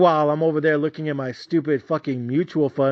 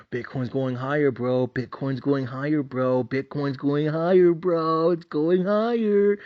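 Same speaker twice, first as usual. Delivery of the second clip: a teasing buddy chanting it over and over; best friend hyping; 2 semitones lower, slower than usual. Retold or imitated. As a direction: drawl out the bro's smug prediction. Imitating best friend's hype.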